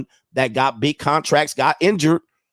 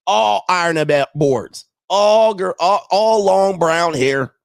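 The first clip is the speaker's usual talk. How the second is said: higher, louder, slow mocking drawl-free stretch; mocking exaggeration; 5 semitones higher, 4 dB louder, slower than usual. Real, not acted. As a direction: stretch it out to mock the Swifties. Mocking Swifties' looks.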